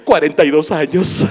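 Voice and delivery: loud, proud mock-mother reply; hammed-up mother delivering the joke's punchline; punchline answer. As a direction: blurt it out like it's nothing odd. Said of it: "¿Qué edad tiene su negrito chiquito bodoque?".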